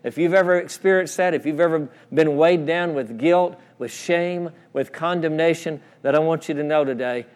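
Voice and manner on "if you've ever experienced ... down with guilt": ordinary delivery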